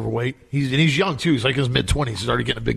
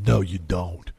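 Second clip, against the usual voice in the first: a lower, flat, deadpan quote of the friend; friend's deadpan reply; 7 semitones lower, slower than usual. Retold or imitated. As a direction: drop the voice low as the friend concedes. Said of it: "And he goes".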